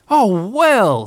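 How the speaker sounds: high, loud motherly exclamation; mock-amazed mother; feigned surprise. A narrator's reading. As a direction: exclaim as if astonished.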